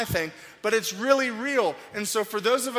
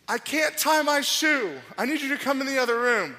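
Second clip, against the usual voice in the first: put-on whiny crying child; 6 semitones higher, 3 dB louder than usual. Young son whining to his father about his shoe. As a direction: play the sobbing little boy who can't tie his shoe. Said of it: "they're crying".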